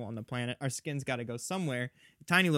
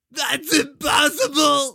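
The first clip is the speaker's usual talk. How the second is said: high, very loud drawn-out cry; hammed-up wail of disbelief; anguished outcry; 12 semitones higher, 12 dB louder, slower than usual. Patient hearing shocking news from the doctor, anguished cry.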